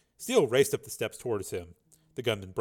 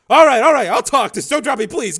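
terrified pleading; 17 dB louder than usual. Acted. Terrified man giving in.

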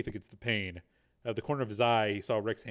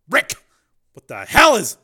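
much louder, higher outburst; alarmed shout; 10 semitones higher, 17 dB louder than usual. A narrator's reading. Alarmed at the door.

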